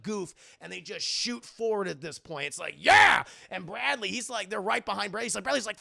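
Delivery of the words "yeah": very high, loud whoop; explosive cheer; triumphant yell. Retold or imitated. Skaters shooting forward together, triumphant yell.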